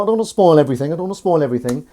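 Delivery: fast excited mock presenter; quick host patter. Teasing the episode fast and excited. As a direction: rattle it off like a hyped host.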